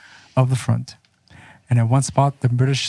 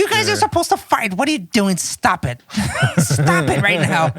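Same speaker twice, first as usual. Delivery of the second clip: high-pitched officer scolding; 7 semitones higher than usual. Acted. Officer voiced yelling at troops.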